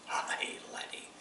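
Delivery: unvoiced whispered aye laddie; whispered reply. Sergeant quietly approves the soldier, whispered. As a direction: breathe it as a grudging approval.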